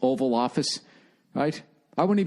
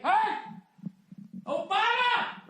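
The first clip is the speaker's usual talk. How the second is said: long high shout across a lawn; calling out; 4 dB quieter, slower than usual. Pretend. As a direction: holler it at the house. Calling to the President at gate.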